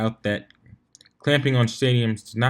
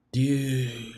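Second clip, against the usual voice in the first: an awed, breathy whisper; breathed whisper; 2 semitones higher, 4 dB quieter than usual. A narrator's reading. Drunk Vinyl awed by the Wonderbolts, breathing it in a whisper. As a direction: let it out on a breath, no voice. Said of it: "she breathed".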